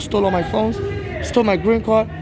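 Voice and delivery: normal talking voice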